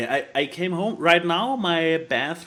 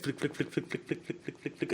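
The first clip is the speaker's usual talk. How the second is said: rapid, hushed flicking noise; imitated page flicking; 3 semitones lower, 12 dB quieter than usual. Describing the Marvel opening's comic pages, imitated flicking. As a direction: flutter softly through the flicks like riffled pages.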